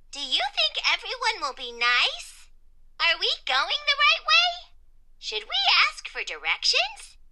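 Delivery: high, wondering character voice with questioning lifts; Question Mark. A narrator's reading. Question Mark wondering about the new school, high questioning voice. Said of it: "Question Mark wondered what it would be like".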